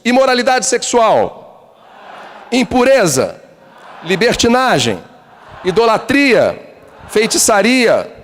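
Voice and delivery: each sin drawn out high and slow; slow emphatic list. Acted. Preacher reading the works of the flesh, slow and weighty. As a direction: pronounce each sin like a verdict.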